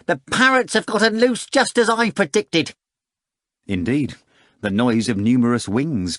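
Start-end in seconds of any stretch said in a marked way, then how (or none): 0.0-2.7 much higher, louder, self-assured cat voice; a smug cat boasting it will win; high showy talking cat, sure of victory; pompous cat. Acted. Cat Behemoth's voice.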